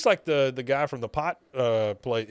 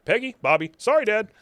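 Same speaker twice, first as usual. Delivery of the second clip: rapid replay; 9 semitones higher, 6 dB louder, faster than usual. Retold or imitated. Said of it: "So we get".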